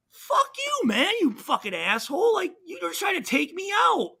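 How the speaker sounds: high, angry retold yell at a cheap-shot hitter. Player yells at opponent after a cheap shot, angry yell. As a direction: bark it back at the guy who tried to level you.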